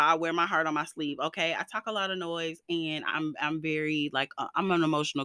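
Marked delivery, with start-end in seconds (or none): none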